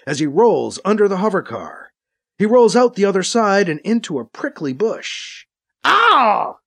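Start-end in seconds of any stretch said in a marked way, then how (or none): 5.8-6.6 high yelp of sudden pain; involuntary yelp. A narrator's reading. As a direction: yell out as if pricked. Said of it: "he yells involuntarily".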